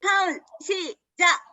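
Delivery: high, drawn-out starting cue; loud rallying call. Real, not acted. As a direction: call the group to begin, slowly and high. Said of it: "큰 소리로 외쳐보도록 하겠습니다".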